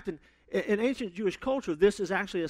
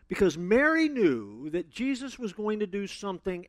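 normal talking voice

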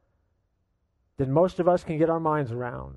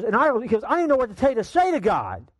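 railing father; 6 semitones higher than usual. Retold or imitated. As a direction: keep ranting without a breath. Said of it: "father kept continuing to rail".

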